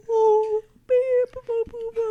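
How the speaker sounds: high, loud cute little bear voice; cute cartoon bear babble; Winnie the Pooh. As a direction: bumble along in tiny high hoots. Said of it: "the cutest little voice in that bear".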